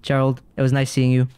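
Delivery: normal talking voice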